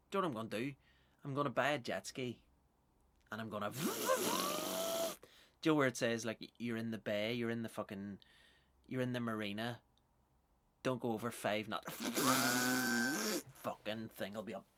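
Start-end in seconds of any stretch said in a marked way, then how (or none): 3.7-5.2 long high engine noise; imitated jet ski. 11.9-13.6 long buzzing engine roar; vocal jet-ski revving noise; imitated jet ski. Imitates jet ski breaking speed limit.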